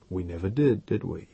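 impassive. A narrator's reading. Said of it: "he said, impassive".

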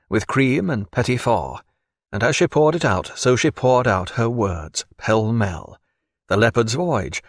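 ordinary delivery, nothing marked

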